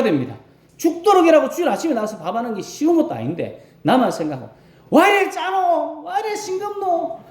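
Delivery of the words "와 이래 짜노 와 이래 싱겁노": complaining grumble. Retold or imitated. Church elder griping about the lunch to the cooks, whiny. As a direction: whine about the food like a picky old man. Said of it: "막 이렇게 이야기할 필요가 없습니다".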